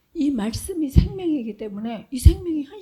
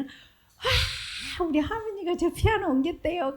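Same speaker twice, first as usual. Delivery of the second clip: put-on bright childish boast, pitched up; mock bragging child; 7 semitones higher, slower than usual. Mother retells child boasting to grandparents, high mock brag. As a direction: imitate a kid showing off.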